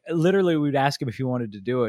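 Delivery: ordinary talk, nothing marked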